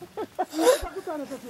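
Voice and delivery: high laugh. Real, not acted. Laughing at the cows.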